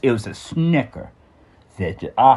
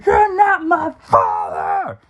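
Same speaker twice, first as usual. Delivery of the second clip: high, slow, drawn-out yell; mock kid's scream at the stepfather; retold scream; much slower than usual. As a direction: shriek it at him like a furious kid. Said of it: "I scream at the top of my lungs".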